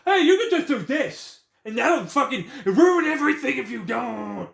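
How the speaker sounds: hammed-up bossy mimicry; mock advice voice. Ranter parodies older sister's advice, high laughing mockery.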